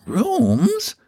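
furry tones. Acted. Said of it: "in her furriest tones".

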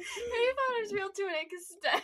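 high, loud, teary laughter; laughing till crying. Real, not acted. Laughing fit.

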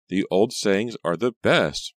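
slow, voiced intimate murmur, not hushed; drawn-out, lilting confidence; mock whisper. A narrator's reading. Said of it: "Reva whispered into his ear".

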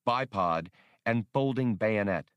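plain reading voice

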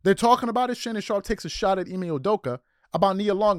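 ordinary talk

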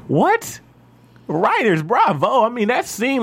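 high-pitched, awestruck raving; blown away. Real, not acted. Podcast host applauds the writers after the scene, blown away. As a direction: gush in amazement, voice shooting way up.

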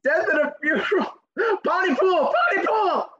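laughing excited shout. Real, not acted. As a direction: blurt it out through laughter. Blurts title, laughing.

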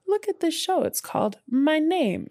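high, lightly voiced sales pitch; mock Netflix pitch. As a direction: pitch the show like an eager ad. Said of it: "Netflix was like".

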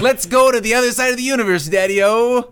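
loud, fast, high greaser voice; greaser tough guy. Retold or imitated. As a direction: ham up a fifties tough guy. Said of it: "imitating the sleazy character".

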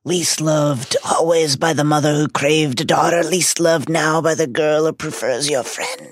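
theatrical, stretched-out cruel intoning; sinister Horcrux voice. Acted. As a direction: needle him like an evil spirit. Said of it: "But the Horcrux continues".

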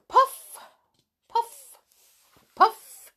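short high puffs of breath; sudden high blowing sound; acted puffing. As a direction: pop each word out high and airy. Acting out blows on dandelion.

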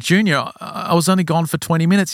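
acted-out flustered excuse; defensive protest. Father excusing his absence to his son, defensive.

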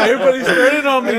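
loud, high laughing complaint; laughing protest. Real, not acted. Host ganged up on by his friends, loud laughing protest. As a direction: cry betrayal through laughter.

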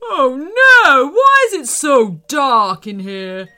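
loud, panicked eagle voice; loud wail from a frightened eagle; fearful yell. A narrator's reading. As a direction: yell out, scared of the dark. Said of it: "yelled the eagle in fear".

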